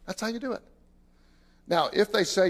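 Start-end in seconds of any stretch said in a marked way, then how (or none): none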